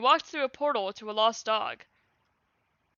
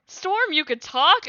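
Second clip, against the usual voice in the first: higher, slow amazed question; surprised exclaim; 6 semitones higher, 5 dB louder, slower than usual. Acted. Violet amazed that her brother can talk. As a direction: stretch it out in wonder. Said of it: "exclaimed Violet".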